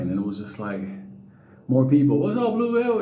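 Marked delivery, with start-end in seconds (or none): 2.2-3.0 high shout-out from a passerby; fan calling out. Retold or imitated. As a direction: call out across the street.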